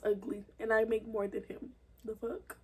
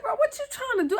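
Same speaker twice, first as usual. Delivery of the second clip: heated challenge; 10 semitones higher, faster than usual. Retold or imitated. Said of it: "So I was like".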